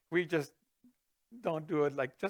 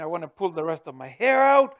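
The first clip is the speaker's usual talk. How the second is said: usual speaking voice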